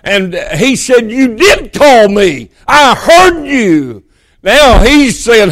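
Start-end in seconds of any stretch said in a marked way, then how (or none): 1.1-4.0 slow, insistent, rising in pitch; very emphatic. Acted. Samuel tells Eli he did call, very emphatic. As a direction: insist on it word by word. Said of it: "very emphatic".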